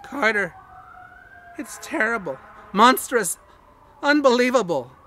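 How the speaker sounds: panicked cry up the line; frenzied. A narrator's reading. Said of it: "Then came the frenzied tones again".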